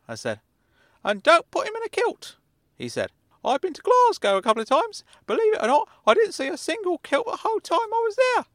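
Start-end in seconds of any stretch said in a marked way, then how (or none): 1.0-2.4 put-on high, thin old uncle voice, much higher and louder; kindly meddling uncle, high and loud; Uncle Claude voice. 3.5-8.5 put-on high, thin old uncle voice, much higher and louder; kindly meddling uncle, high and loud; Uncle Claude voice.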